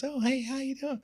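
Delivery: very high, airy, casual voice; imitated high-pitched, no-big-deal hello; Michael. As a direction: greet lightly in a soft falsetto. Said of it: "like it's not a big deal".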